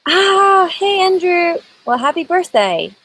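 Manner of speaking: high, delighted hello to a friend; excited greeting. Real, not acted. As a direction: burst into a happy birthday greeting. Greeting viewer on his birthday.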